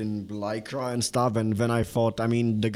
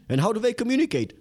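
very high, quick question; baffled alien; 12 semitones higher, faster than usual. Retold or imitated. One alien questioning the other, high and baffled. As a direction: fire off the baffled question, pitched way up. Voicing incredulous aliens from story.

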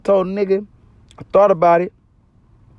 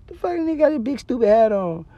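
mocking jab; 9 semitones higher than usual. Mocking a guy's hat.

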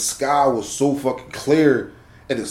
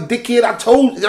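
retold snap; 9 semitones higher than usual.

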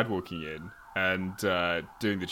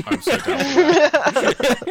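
excited; 8 dB louder, much slower than usual. Real, not acted. Keen reply to hug offer.

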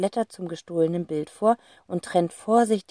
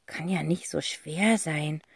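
softer, slow murmur; 2 semitones lower, 7 dB quieter, slower than usual. Acted. Sister murmuring to herself at her sketch, soft mumble. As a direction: mumble it to yourself. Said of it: "murmelt Cassie".

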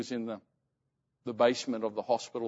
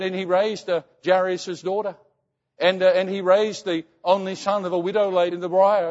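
imitated fervent mother, higher and louder; praising God; 5 semitones higher, 6 dB louder than usual. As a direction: proclaim miracles as the believing mother. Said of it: "she was praising God".

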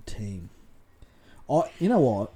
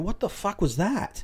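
mock baffled; 8 semitones higher than usual.

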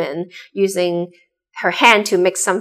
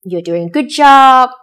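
higher, louder pat on the back; raised-voice mock approval; imitated praise; 4 semitones higher, 8 dB louder than usual. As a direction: praise someone, voicing an approving boss. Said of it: "people say".

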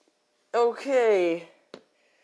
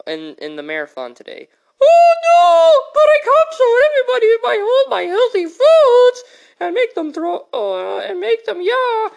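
very high, loud, wailing lady; shrieking aunt; 12 semitones higher, 9 dB louder than usual. Acted. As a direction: wail it like a dramatic aunt. Aunt Patricia loud high wail.

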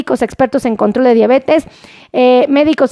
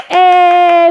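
higher, drawn-out cheering "eh"; celebratory "eh" cheer; 4 semitones higher, 4 dB louder than usual. Real, not acted. Host applauds the fastest viewer, celebratory cheer. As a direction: cheer a winner with a long "eh".

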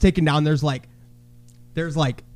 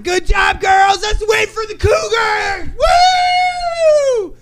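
high, loud sarcastic cheering; hyping cheer; 3 dB louder, much slower than usual. Retold or imitated. Parent mock-cheering the girls' team from the stands. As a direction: whoop it up for the team. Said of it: "I was like".

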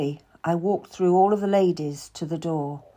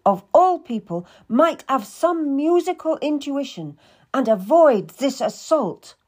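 imperious fury; 6 semitones higher, 4 dB louder than usual. Acted. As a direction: scold her like a wounded queen. Queen rages about the lute.

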